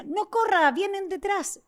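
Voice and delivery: high-pitched urgent street warning; retold warning shout. Street vendor warning a robbed woman, high retold call. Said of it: "en ambulante me decía".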